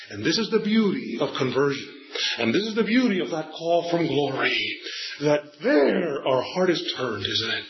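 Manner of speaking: normal talking voice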